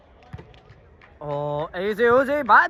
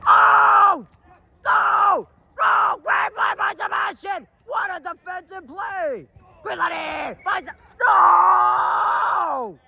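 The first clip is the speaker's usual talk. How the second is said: higher, shouted play-by-play; frantic excitement; 9 semitones higher, slower than usual. Real, not acted. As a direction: yell each moment as it happens. Tense moment in the match.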